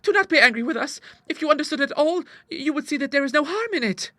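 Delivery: put-on very high-pitched girlish entreaty; pleading daughter. A narrator's reading. Daughter begs father not be angry, high pleading. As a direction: plead high and earnestly, soothing an angry parent. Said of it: "cried Clara, throwing her arms about him".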